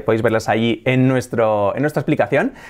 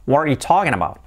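linked English, "wha-ree-you TAW-kin abow"; reduced, fluent native English accent. Shown as a demonstration. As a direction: run the words together like a native. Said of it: "Cambia mucho decir".

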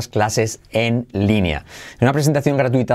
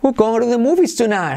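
high, bright cheering delivery; excited; 10 semitones higher than usual. Shown as a demonstration. Teacher celebrating the natural version, much higher. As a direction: sing it out high like a win.

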